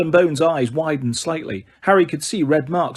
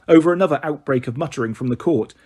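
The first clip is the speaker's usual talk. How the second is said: plain reading voice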